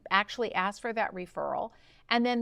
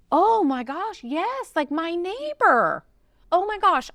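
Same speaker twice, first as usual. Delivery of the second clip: higher and louder, gushing with sudden enthusiasm; excited client; 7 semitones higher, 6 dB louder, slower than usual. Retold or imitated. Client gushing to her coach about calling her neighbor. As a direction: play the delighted client who just thought of someone.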